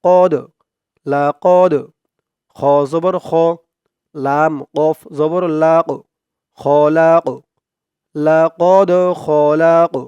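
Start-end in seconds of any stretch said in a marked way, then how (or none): 0.0-1.9 কদ with a bouncing echo on দ; qalqala bounce. Shown as a demonstration. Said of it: "ধাক্কা দিয়ে পড়তে হবে". 6.6-10.0 খলাক with a bouncing ক at the end; qalqala bounce. Shown as a demonstration. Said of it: "এই কফটাও কলকলার হরফ".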